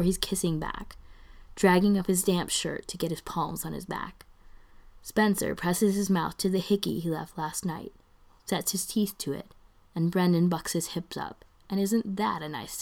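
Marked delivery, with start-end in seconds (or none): none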